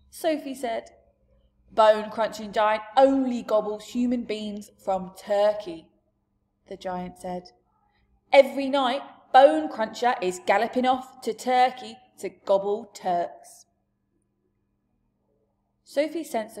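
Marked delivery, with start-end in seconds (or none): 1.7-5.9 a big friendly giant holding forth; giant's loud booming talk. Acted. 8.3-13.7 a big friendly giant holding forth; giant's loud booming talk. Acted.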